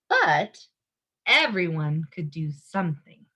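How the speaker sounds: plain reading voice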